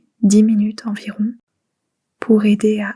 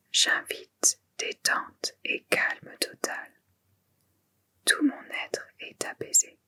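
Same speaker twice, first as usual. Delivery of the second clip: very soft, voiceless whisper; whispered mantra; 10 dB quieter than usual. Shown as a demonstration. Yoga teacher echoing the Sankalpa for silent repetition, whispered. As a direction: whisper it as if only thinking it.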